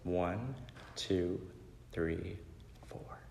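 soft count, fading away; calming count. Acted. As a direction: count quietly to yourself to settle down. Mom counting to calm down.

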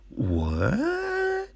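high, soft, disbelieving what; stunned what. Retold or imitated. Hearing the worker was paid for years, high stunned disbelief. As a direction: gasp the word out in disbelief. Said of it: "I'm like".

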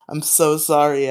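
ordinary delivery

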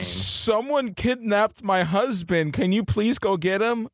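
high, drawn-out mock plea; pleading quest giver. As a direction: beg for help in a put-on high voice.

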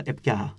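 Έπια with a breath of h between the vowels; aspirated middle h. Shown as a demonstration. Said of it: "δασί πνεύμα ανάμεσα σ". (Έπια με δασύ χ ανάμεσα στα φωνήεντα)

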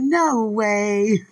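loud, firm "No way"; emphatic no way. Retold or imitated. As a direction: repeat it loud and final. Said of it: "That's how they said it".

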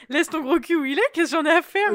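loud laughing retort. Real, not acted. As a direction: laugh while snapping back.

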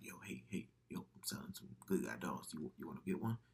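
low, hushed imitation of an alley dealer; soft, low mock hustler; shady street seller. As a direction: sell it furtively under your voice. Acting a furtive doll seller.

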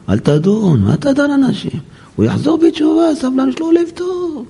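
high-pitched put-on woman's voice; imitated protesting wife. Wife defending her husband to the rabbi, high imitated protest.

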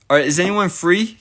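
ordinary talk

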